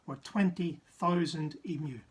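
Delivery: ordinary delivery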